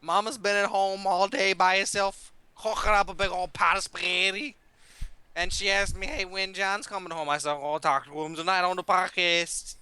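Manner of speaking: lightly voiced Italian mobster accent; a hint of an Italian wiseguy. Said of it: "You almost lost your accent there".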